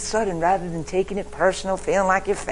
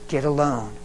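normal talking voice

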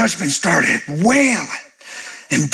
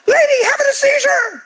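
high, alarmed mock onlooker; a hint of a panicked shopper; mock panicked bystander. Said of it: "They're like".

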